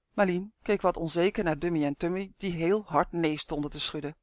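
ordinary delivery

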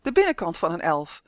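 plain reading voice

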